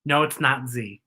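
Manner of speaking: ordinary delivery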